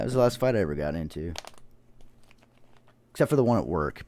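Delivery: ordinary talk, nothing marked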